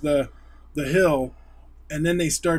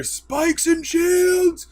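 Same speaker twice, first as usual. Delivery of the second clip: battle cry; 13 semitones higher, 3 dB louder, much slower than usual. Retold or imitated. Soldiers called out in battle.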